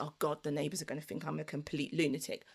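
quick hushed self-conscious worry; muttered worry. Retold or imitated. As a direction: rush it under your breath. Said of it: "I was like".

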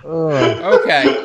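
okay through laughter; the clerk's okay, cracking up; laughing while voicing the clerk. Clerk dismisses player, laughing.

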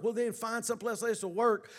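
ordinary talk, nothing marked

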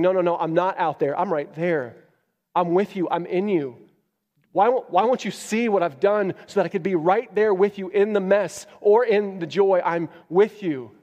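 earnest, pleading voice of God; God calling to his people, pleading to be seen. Acted. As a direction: plead as God, lovingly insistent.

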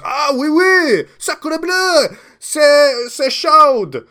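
high, loud French chef voice. Acted. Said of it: "and he goes".

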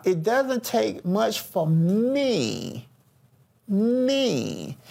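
"me" drawn out long with a swooping pitch, then repeated alone; slow the line and lean hard on "me"; stress on ME. Shown as a demonstration. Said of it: "listen at the emphasis now".

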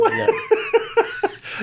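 high, slow, laughing through it. Real, not acted. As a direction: crack up while saying it. Laughing while denying.

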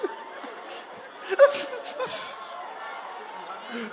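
breathy fake crying fit; hammed-up sobs; mock sobbing. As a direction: weep theatrically. Acted sobbing.

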